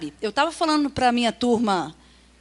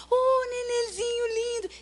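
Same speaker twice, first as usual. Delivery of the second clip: put-on high, slow cooing baby talk; high sing-song voice for an infant; 11 semitones higher, slower than usual. Shown as a demonstration. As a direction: coo sweetly to a baby. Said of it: "a gente fala com criança assim".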